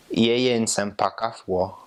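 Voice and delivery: slow, careful Twi "Yeye Nsempakafwo"; Twi phrase. Shown as a demonstration. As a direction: spell out "Yeye Nsempakafwo" slowly in a Ghanaian way. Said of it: "my accent sounds funny".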